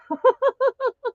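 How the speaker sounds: high giggle over the name; sheepish giggle; embarrassed laugh. Real, not acted. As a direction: laugh off the slip. Laughing at her name trouble.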